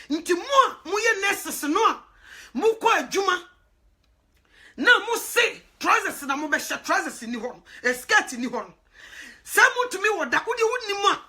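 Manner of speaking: loud, emphatic preaching; rising sermon fervour. Real, not acted. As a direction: drive the point home to the nurses. Preaching at nurses, building force.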